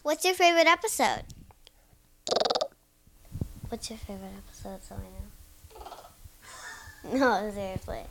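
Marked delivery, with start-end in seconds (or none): 3.7-5.0 much softer, fast hushed repeat; quick hushed aside. Real, not acted. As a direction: rush it under your breath.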